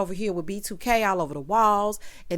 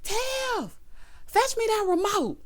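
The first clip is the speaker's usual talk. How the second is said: much higher put-on mom voice calling out; Tiff's mama calling; 13 semitones higher, slower than usual. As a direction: holler for her daughter like a demanding mother. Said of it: "Here go Tiff mama".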